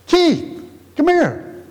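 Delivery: high-pitched call across the room, retold; dad calling out. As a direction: call the boy over, imitating dad. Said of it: "My dad said".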